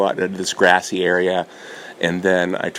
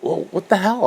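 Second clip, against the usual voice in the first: much higher, disbelieving outburst; incredulous; 12 semitones higher than usual. Retold or imitated. Shocked by the duffel bags of cards, high disbelief. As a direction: squeak it out in disbelief. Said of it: "I'm like".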